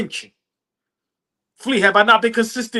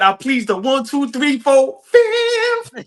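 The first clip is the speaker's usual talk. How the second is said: imitating; 4 semitones higher, slower than usual. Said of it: "He said".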